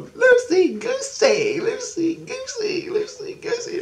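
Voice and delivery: very high squeaky chant; mimicked child chanting on and on; childlike innocence. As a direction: stretch it into a silly singsong squeal. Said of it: "my innocence is going".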